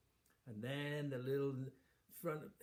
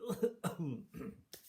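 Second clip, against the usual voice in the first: higher hammed-up cough; fake cough; 7 semitones higher, 5 dB louder than usual. Queen faking a cough after the king leaves. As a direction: cough theatrically to keep up the act. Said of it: "she was only pretending".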